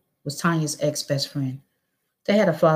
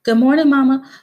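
put-on nervous child; 10 semitones higher, 10 dB louder than usual. A narrator's reading. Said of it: "Ayanna said nervously".

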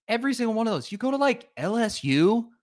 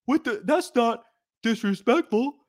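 mock whiny denial; 8 semitones higher than usual. Host mimics Auburn fans denying disrespect, high whine. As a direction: whine it like a fan denying guilt. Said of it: "then be like".

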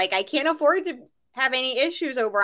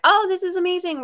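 higher, gushing shopper voice; mock gushing shopper; 10 semitones higher, 4 dB louder than usual. Imitating a stranger gushing at her clearance cart, higher. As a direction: gush over the cart.

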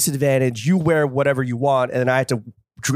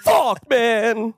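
frustrated shout; 8 semitones higher than usual. Real, not acted. Still frustrated about soccer.

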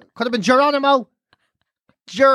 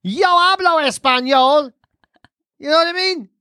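showing off Spanish; 6 semitones higher than usual. Retold or imitated. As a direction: ham up speaking Spanish. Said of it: "I was giving it all of that shit".

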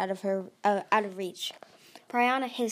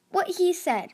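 high, louder urgent agreement; desperate; 9 semitones higher, 5 dB louder than usual. A narrator's reading. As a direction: seize on his point desperately. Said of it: "Tsunami said desperately".

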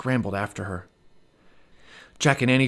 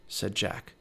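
usual speaking voice

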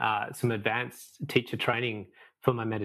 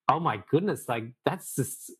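higher-pitched, retold astonishment; disbelief; 5 semitones higher than usual. Telling teachers about extreme tapas, retold disbelief. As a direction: imitate your own baffled reaction. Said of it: "I couldn't get my head around it".

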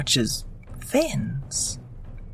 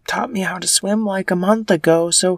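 put-on high cheeky girl voice, louder; breezy little sister, pitched up; Leah voice; 8 semitones higher, 5 dB louder than usual.

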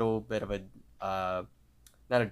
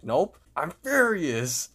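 slow, higher, louder put-on rage; mock furious; 7 semitones higher, 4 dB louder, slower than usual. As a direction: drop the act and let the fury show. Muscle Man turns on them.

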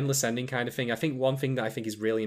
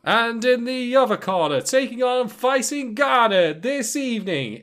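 higher, louder, slow boxing announcer build-up; ring announcer; 11 semitones higher, 7 dB louder, slower than usual. Pretend. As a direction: draw out the fighter intro like a ring announcer.

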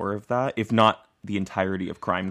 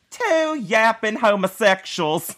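much higher, louder, slow scornful mock voice; Nancy Grace imitation; 13 semitones higher, 8 dB louder, much slower than usual. Imagining Nancy Grace sneering at their podcast, high and drawn out. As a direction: drag it out like a disdainful TV host. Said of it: "She's like".

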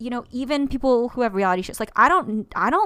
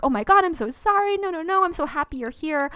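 high, louder rush of apology; gushing apology; 7 semitones higher, 4 dB louder than usual. Acted. Author to anyone feeling left out, gushing apologetic reassurance. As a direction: fall over yourself apologizing and welcoming, pitched up. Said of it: "I will immediately be like".

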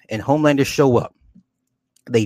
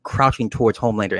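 ordinary delivery

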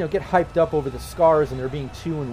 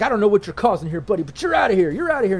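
raised, higher gruff guard; bouncer voice; 5 semitones higher, 3 dB louder, faster than usual. Acted. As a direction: bark them out of the venue. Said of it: "And he's like".

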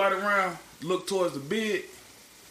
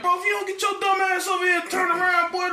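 a much higher, louder urgent shout; warning yell; 4 dB louder than usual. Retold or imitated. Officer yelling at the maintenance man to turn around, loud urgent shout. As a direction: yell the warning across the room.

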